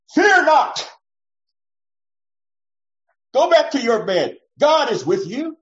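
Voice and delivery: high, loud, stern order; harsh booming command. Pretend. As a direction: bark it at the frightened child. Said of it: "I don't think you would speak to them in that tone".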